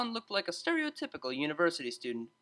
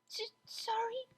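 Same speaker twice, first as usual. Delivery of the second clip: tiny, very high whispered squeak; 6 dB quieter, slower than usual. A narrator's reading. Small mare squeaking an apology to the DJ. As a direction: shrink as you apologize. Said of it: "she squeaked".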